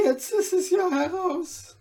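high, plaintive confession; frightened old tailor. A narrator's reading. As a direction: wail it out in a high thin voice.

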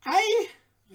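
high, sharp little dwarf breaking off; abrupt little dwarf exclamation; sudden exclamation. A narrator's reading. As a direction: cut yourself off with a sudden thought. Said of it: "rief er plötzlich abbrechend".

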